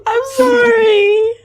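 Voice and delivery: crying sorry. Acted. Seren sobs another apology to Alara. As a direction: whimper it out.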